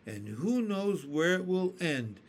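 usual speaking voice

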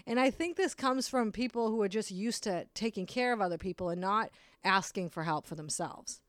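normal talking voice

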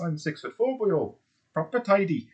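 high, lilting bad Welsh accent; mock Welsh accent. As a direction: do a hammed-up Welshman, pitched high. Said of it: "how great that accent was".

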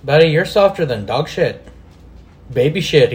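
usual speaking voice

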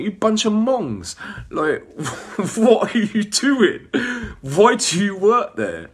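high, loud, slow jeering insult with laughter; mocking insult. Jeering at the workers, loud and laughing. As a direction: sneer at them through laughter, drawn out. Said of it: "You're saying".

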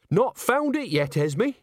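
high, slow, cheerful comeback; bright. A narrator's reading. Witch cheerfully answers her friend's reproach, bright. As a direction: answer brightly and unashamed, pitch well up. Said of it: "said Nanny brightly".

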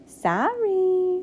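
high, drawn-out fake sorry; insincere apology. A narrator's reading. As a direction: sing out a sorry you don't mean. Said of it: "he wasn't sorry, not one bit".

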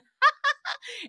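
high, loud burst of laughter; real cackle, high and sudden. Real, not acted. Host amused by Luann as coke queen, high cackle.

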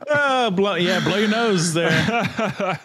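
laughing through the words. Real, not acted. Laughing at the sad movie scene.

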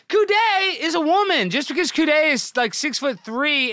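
high, loud sarcastic protest; mock the critics loudly; sarcastic outrage. Streamer mocks the complaint, loud and high.

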